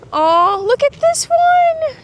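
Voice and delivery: very high, loud, drawn-out coo; cooing at animal. Real, not acted. Vlogger spotting an ostrich up close, high delighted coo. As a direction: coo in a squeaky-high, slow voice.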